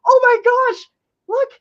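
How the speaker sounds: high, loud gasp of delight; delighted squeal. Real, not acted. Seeing packaging like WPA posters, high loud squeal. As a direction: gush at the pretty packaging.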